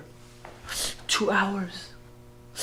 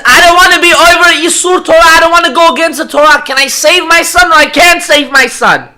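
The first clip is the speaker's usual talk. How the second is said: loud, high, fast outpouring; agonized plea; 11 semitones higher, 16 dB louder than usual. Retold or imitated. Father torn between Torah and his son, agonized plea. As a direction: shout the dilemma out in a rush.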